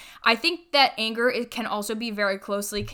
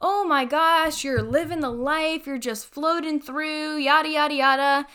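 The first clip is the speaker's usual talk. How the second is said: envious onlookers, mock; 5 semitones higher than usual. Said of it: "Most people look at that".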